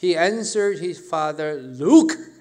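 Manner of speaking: angry Look. Acted. Son snapping at his father, loud and angry. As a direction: bark the word Look in anger. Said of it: "얼마나 화가 났는지".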